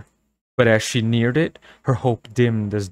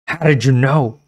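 harsh raspy demand, louder; raspy angry voice; 4 semitones higher, 6 dB louder than usual. A narrator's reading. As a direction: rasp it out, angry and confused. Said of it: "raspy voice ... anger and confusion".

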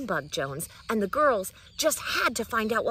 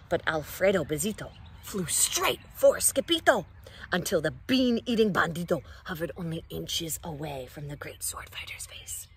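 ordinary delivery, nothing marked

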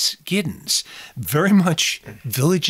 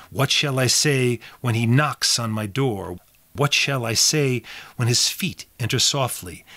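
dramatic poem reading; 6 semitones lower than usual. Shown as a demonstration. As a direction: read it like a chilling ghost poem, slowing down. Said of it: "I just wanna read a couple lines".

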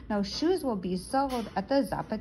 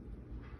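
shocked gasp; 17 dB quieter than usual. Acted.

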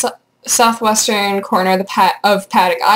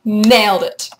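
ordinary talk

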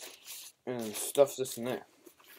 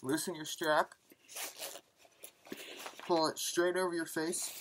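muffled behind mask; speaking inside respirator; through a gas mask; 4 semitones higher, 4 dB quieter than usual. Real, not acted.

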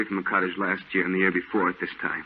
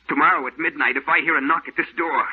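building higher and louder; rising resolve; 9 semitones higher, 6 dB louder than usual. Acted. Painter declaring he will fire at the ghost. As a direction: work yourself up to it.

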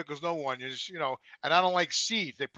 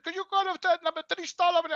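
mock worker voice; 13 semitones higher, faster than usual. Imitating drive-through worker.

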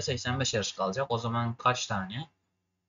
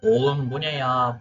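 loud shocked outburst; 7 dB louder, slower than usual. Real, not acted. Shocked at traffic.

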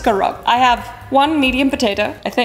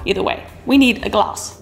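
ordinary talk

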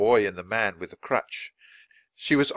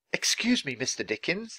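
shy, high-pitched approach to a stranger; timid high voice; 6 semitones higher, 3 dB quieter than usual. A narrator's reading. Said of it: "the woman said shyly".

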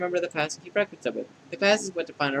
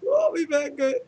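high wail; 12 semitones higher, 5 dB louder than usual. Acted.

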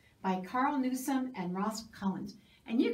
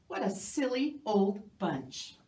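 ordinary delivery, nothing marked

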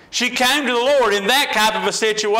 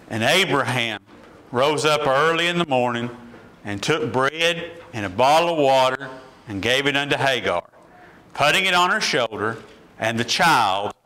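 scripture reading; 6 semitones lower than usual. Acted. Preacher reading Genesis to the standing congregation, solemn. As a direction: read the Bible aloud with reverence.

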